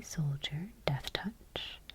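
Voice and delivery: usual speaking voice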